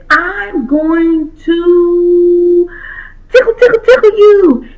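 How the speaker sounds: slow start, then tickle rising high and loud; playful tickle threat. Acted. Fox cheering up the crying girl, playful tickling. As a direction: build up to a gleeful tickle attack.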